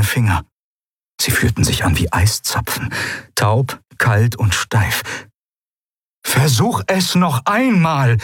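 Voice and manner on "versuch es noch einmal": high, slow, insistent call. A narrator's reading. Eidolon urging Kai at the field, high insistent call. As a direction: press for one more attempt. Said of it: "rief das Eidolon".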